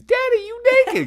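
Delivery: very high, loud imitation of a toddler; piping little-kid shriek, imitated; screaming toddler. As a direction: mock-scream it like a small child. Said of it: "he comes in and he screams".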